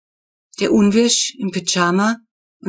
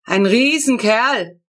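higher, loud, slow proud call; calling back; 5 semitones higher, 5 dB louder, slower than usual. A narrator's reading. Neighbour calling back proudly about his kill. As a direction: boast it back across the garden. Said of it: "ruft der Unwirsch zurück".

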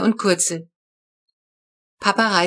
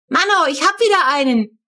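put-on higher, louder child's cheer; jubilant; 8 semitones higher, 4 dB louder than usual. Flo cheering in the garden over a fat worm, jubilant. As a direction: crow over finding another worm. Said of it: "jubiliert Flo".

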